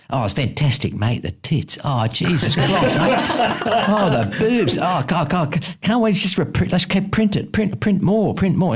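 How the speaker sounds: excited, high-pitched mock Cockney producer; John Daly impression. Producer gushing on the phone to his director, excited mock Cockney. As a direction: gush like a giddy Cockney, voice climbing high. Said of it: "I can't do his accent, but it was a Cockney accent".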